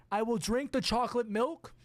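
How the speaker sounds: ordinary talk, nothing marked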